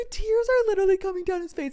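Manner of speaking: high, quick, amused telling; giggly, high-pitched narration; through laughter. Real, not acted. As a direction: squeeze it out just after cracking up. Still laughing as she retells.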